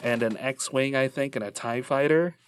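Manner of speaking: ordinary talk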